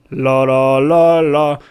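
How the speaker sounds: loud, well-placed sung la la with chest; full-bodied la. Shown as a demonstration. Coach showing students the right la with body. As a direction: sing it round and grounded. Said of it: "la segunda se escucha con cuerpo".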